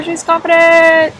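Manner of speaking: high, loud mock shout; hammed-up proud yell; rooftop proclamation. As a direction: scream it from the rooftop. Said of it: "scream it from the rooftop".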